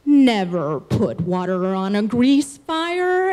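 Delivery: deep, slow mock bear ranger; Smokey the Bear. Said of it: "Smokey the Bear comes at me one last time and says".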